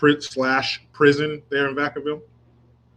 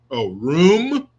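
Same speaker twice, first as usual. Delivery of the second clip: put-on Baltimore accent; 3 semitones higher, 4 dB louder than usual. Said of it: "Baltimore isms in the way she talks".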